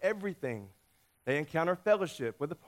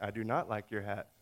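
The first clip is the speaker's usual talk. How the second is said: lightly voiced dog, lower and softer; unimpressed yellow dog; 7 semitones lower, 5 dB quieter than usual. Yellow dog answering the hat question, unimpressed. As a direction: turn the hat down in a low, subdued voice. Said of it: "And he's like".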